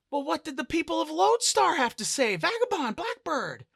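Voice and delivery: loud, high, agitated questioning; urgent demand. Acted. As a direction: press for answers in alarm. Dancer urgently presses Hawking for answers.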